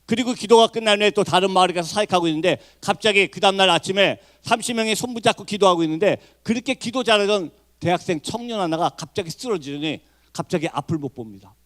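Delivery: ordinary talk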